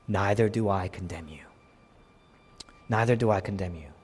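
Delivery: low, tender voice of pardon; gentle, patient. Acted. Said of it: "gentleness. Look at his patience".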